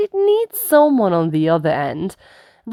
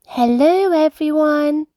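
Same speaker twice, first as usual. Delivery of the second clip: put-on higher, bright little girl greeting; Rebecca Rabbit voice; 9 semitones higher than usual. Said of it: "says Rebecca".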